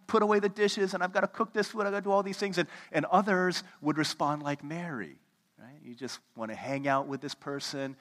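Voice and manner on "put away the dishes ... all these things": higher, hurried list of chores; flustered host racing to get ready; frantic rush. Retold or imitated. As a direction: panic about cleaning before guests. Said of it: "we'd be like".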